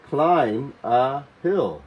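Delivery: "Climb a hill" stretched very slowly; very slow model. Shown as a demonstration. Teacher modelling the phrase for children, very slowly. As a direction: draw out every word of "Climb a hill".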